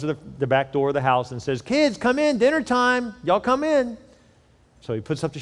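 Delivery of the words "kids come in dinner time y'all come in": high calling grandma voice, much higher. Retold or imitated. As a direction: call the kids in like grandma. Grandma calling kids to dinner.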